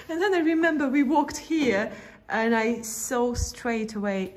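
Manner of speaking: ordinary delivery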